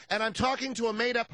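high-pitched smug woman; Mensa lady voice. Retold or imitated. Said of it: "a fake voice".

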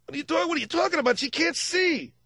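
high, exasperated hey hey; hammed-up cop teacher; Dennis Franz as teacher. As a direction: badger a deaf pupil. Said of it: "Dennis Franz is the, in the Miracle Worker".

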